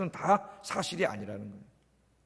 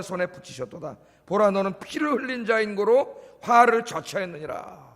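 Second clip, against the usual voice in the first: scripture reading; 5 dB louder than usual. Acted. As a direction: read Shimei's curse aloud with force. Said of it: "한번 읽어보겠습니다". (시므이의 저주를 힘있게 낭독)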